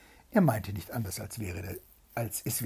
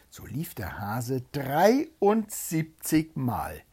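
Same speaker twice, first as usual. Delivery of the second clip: plain reading voice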